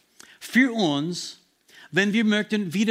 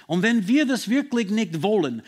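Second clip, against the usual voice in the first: ordinary talk, nothing marked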